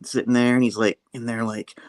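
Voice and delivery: ordinary talk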